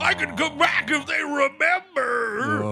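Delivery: high, strained, drawn-out monster cry; hammed-up villain shout, stretched out; Freddy Krueger. Acting Freddy Krueger's comeback.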